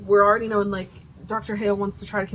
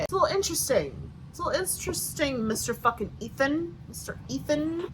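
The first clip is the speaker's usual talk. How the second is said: sarcastic taunt; 5 semitones higher, 4 dB quieter than usual. Pretend.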